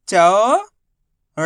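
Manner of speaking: चौड़ाई split into चौ, ड़ा, ई with pauses; syllable by syllable. Shown as a demonstration. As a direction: stretch चौ upward, then ड़ा and ई separately. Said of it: "direct मात्रा का उच्चारण करके".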